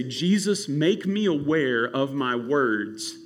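soft, slow, hushed prayer; quiet prayer. Pretend. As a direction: pray it softly, slowly, and humbly. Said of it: "You gotta say".

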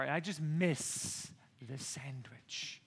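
slow soft whisper; whispered longing. Real, not acted. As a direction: breathe it out like you miss it. Missing sandwich.

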